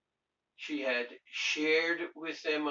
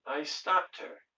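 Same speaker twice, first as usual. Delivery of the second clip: normal talking voice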